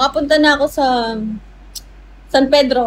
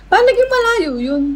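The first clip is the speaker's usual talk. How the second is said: incredulous; 10 semitones higher than usual. Real, not acted. Incredulous at viewer.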